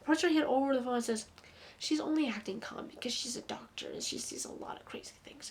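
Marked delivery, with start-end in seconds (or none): 1.8-5.5 a dismissive whisper, imitating; softer, unvoiced and offhand; eye-rolling whisper. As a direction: hiss it out, rolling your eyes. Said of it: "she says it exactly like that with rolling her eyes".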